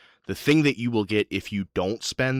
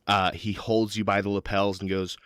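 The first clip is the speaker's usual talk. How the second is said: usual speaking voice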